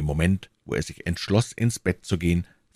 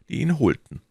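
usual speaking voice

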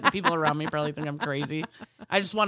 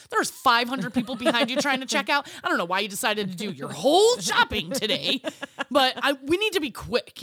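mock sassy retort; 5 semitones higher, 5 dB louder than usual. Retold sass to customer.

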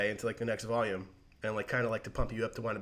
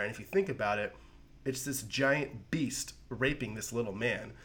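ordinary delivery

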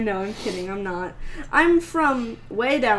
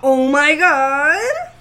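loud slow exclamation; 3 semitones higher, 8 dB louder, much slower than usual. Real, not acted. Exclaiming at aneurysm.

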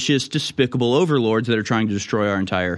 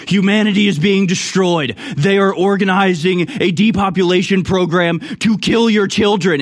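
higher, louder doomsday rant; Alex Jones rant; 9 semitones higher, 6 dB louder than usual. Retold or imitated. Host mocking a ranting broadcaster, alarmed shouting. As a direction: sound the alarm like a frantic radio host. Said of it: "And then it's Alex Jones being like".

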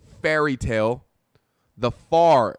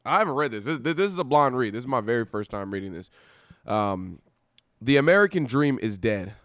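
ordinary talk, nothing marked

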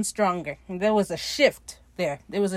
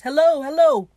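high, loud hello into a void; pretend calling out; 7 semitones higher, 9 dB louder than usual. Calling into an empty echoing head, pretend calling out. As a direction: call playfully into an empty space.

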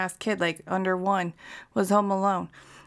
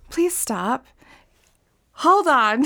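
higher and louder, pleading to stop; shocked protest; 5 semitones higher, 7 dB louder, much slower than usual. Retold or imitated. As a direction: stop it, stop it, I can't take this. Reacting in shock to the audiobook.

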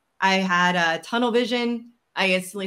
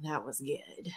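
much softer, low impressed damn; hushed awe; 4 semitones lower, 11 dB quieter, much slower than usual. Retold or imitated. Admitting Katie won the argument, soft awed murmur. Conceding friend's winning argument.

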